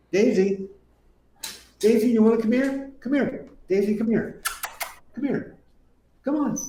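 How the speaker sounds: high sing-song dog call. Real, not acted. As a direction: coax the dog over in a high voice. Calling the dog over.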